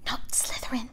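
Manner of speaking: soft, unvoiced pleading; desperate whispered prayer; whispered thought. A narrator's reading. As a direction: beg silently inside his head.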